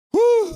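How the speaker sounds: short, high loud whoop; a sharp burst of "woo"; quick piercing celebratory hoot; cheering whoop. Real, not acted. Cheering gifted memberships.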